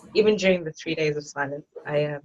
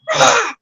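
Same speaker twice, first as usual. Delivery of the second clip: high, loud cry of exasperation; frustrated yell; 9 dB louder than usual. Retold or imitated. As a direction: let out a re-enacted yell of frustration. Her angry cry during the exercise.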